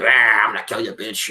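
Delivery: lightly growled doll threat; a hint of a menacing killer toy; mock killer doll. Imitating Buddy's goofy kill lines, mock menace.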